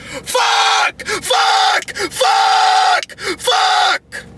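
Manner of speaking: repeated very high-pitched screams; real furious screaming. Real, not acted. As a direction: scream out all the pent-up rage. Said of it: "I'm just fucking screaming".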